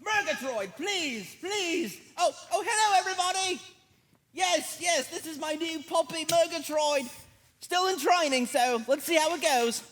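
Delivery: high, soft puppet voice; a timid little puppet character; hushed high puppet talk. Acted. Puppet puppy character speaking.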